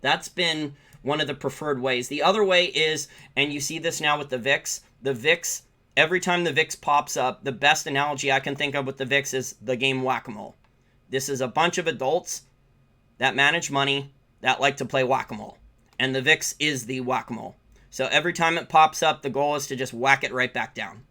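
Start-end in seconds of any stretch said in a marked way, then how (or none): none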